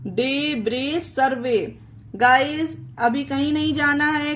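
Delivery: ordinary delivery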